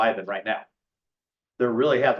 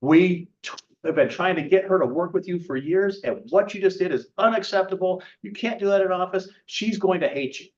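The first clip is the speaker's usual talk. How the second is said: higher-pitched, exasperated telling-off; re-enacted outrage, pitched up; retold indignant scolding; 7 semitones higher than usual. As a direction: act out bosses chewing someone out. Owners scolding him, retold.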